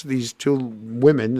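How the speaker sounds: normal talking voice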